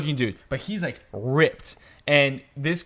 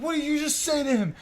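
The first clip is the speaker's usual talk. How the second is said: high, strained demand without shouting; imitating a stuck, insistent aggressor; tense challenge; 13 semitones higher, 4 dB quieter than usual. Stranger repeating himself to the teller, high tense challenge.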